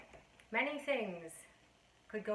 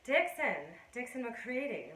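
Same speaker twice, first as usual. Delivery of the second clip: mimicked self-important name announcement, pitched up then falling; raised, mocking self-introduction; Dixon McCready; 2 semitones higher, 3 dB louder than usual. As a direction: imitate a man announcing his own name. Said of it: "the way he said his own name".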